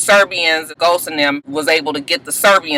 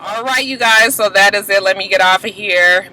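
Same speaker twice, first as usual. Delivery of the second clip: ordinary delivery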